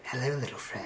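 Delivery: soft, hushed sultry voice. Retold or imitated. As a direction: coo it softly like a seductress. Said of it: "the sultry voice when she's talking to it".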